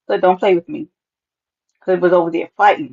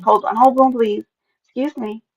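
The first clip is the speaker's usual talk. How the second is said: normal talking voice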